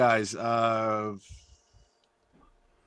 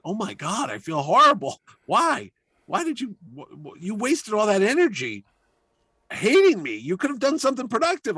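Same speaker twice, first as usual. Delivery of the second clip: higher, agitated mock outrage; retold dismay; 9 semitones higher, 3 dB louder than usual. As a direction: replay his flustered scolding, pitched up. Chaykin's guilty, exasperated outburst.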